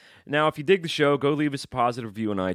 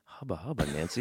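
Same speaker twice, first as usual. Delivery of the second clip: leering hubba hubba; 5 dB quieter than usual. Pretend. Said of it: "allow me ... to say".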